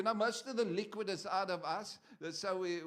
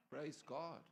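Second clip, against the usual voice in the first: normal talking voice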